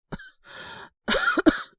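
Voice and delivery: harsh feverish cough; loud rasping sickbed cough. Acted. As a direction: cough hard from a sore chest. Sick boy in bed.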